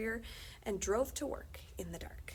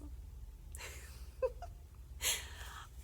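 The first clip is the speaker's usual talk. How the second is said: giggle; 7 dB quieter than usual. Real, not acted. Reader laughs at the joke.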